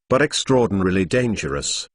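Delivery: ordinary delivery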